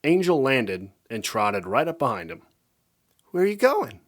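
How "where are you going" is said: sweetly. A narrator's reading. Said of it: "she asked sweetly".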